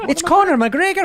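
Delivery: high, laughing Irish Conor McGregor; Irish accent. Shown as a demonstration. Showing how to say the fighter's name, Irish accent. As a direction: say the name with a big Irish lilt. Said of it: "no way to not say his name Irish".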